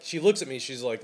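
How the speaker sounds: ordinary talk, nothing marked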